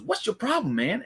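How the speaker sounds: high-pitched retold confrontation; cocky challenge. Jimmy turning on Jay beside him, cocky challenge. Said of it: "cocky and arrogant".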